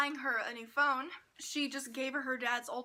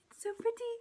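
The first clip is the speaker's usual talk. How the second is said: high-pitched coo of admiration; high, smitten little squeal; gushing delight; 9 semitones higher, faster than usual. Real, not acted. Gushing over the shiny cover.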